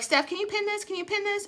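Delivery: high, small, soft voice; imitated pleading request. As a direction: replay a little plea, high and quiet. Retells calling on absent helper.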